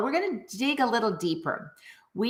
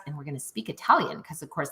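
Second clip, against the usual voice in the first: normal talking voice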